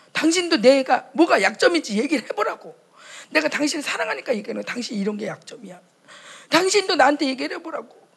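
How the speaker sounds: a wife loudly demanding to be told her faults; demanding quarrel. Retold or imitated. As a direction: push him to criticize you, loudly. Acted marital quarrel, demanding.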